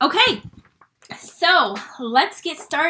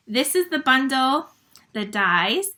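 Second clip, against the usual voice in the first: ordinary talk, nothing marked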